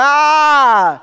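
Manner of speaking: loud, high mock infant cry; imitated baby wail. Preacher acting out his crying infant, a loud high wail. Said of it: "I'm just crying every night".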